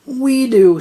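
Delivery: timid. A narrator's reading. Cowering before the ghost.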